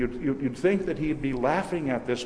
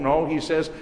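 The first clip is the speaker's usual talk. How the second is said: ordinary delivery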